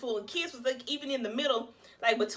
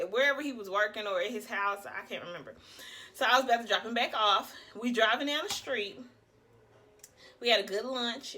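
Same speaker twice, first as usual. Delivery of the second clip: ordinary talk, nothing marked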